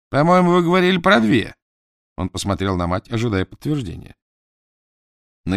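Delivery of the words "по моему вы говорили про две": high, louder accusing doubt; suspicious disbelief. Acted. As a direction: throw the doubt back at them. Dot doubts his parents.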